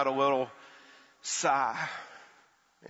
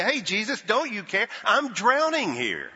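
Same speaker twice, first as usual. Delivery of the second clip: desperate plea; 8 semitones higher than usual. Pretend. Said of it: "go over and shake him and say".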